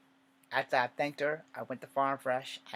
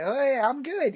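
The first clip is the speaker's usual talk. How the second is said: high breezy brush-off; 13 semitones higher, 10 dB louder than usual. Retold or imitated. Brushing off the doctor.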